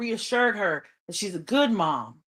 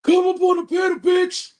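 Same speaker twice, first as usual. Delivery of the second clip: imitating her yelling; 10 semitones higher than usual. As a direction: belt it like she's calling someone out onstage. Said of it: "started yelling".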